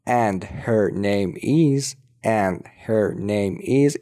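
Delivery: "and her name is" word by word; slow, careful. Shown as a demonstration. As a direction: spell out "and her name is" slowly, h sounded. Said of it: "اینو اگه بخوایم سریعتر بگیم". («and her name is» آهسته و شمرده)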